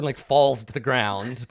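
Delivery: ordinary talk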